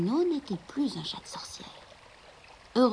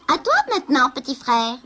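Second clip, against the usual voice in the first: teasing sister cat; 4 semitones higher, 7 dB louder than usual. Acted. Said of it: "dit-elle".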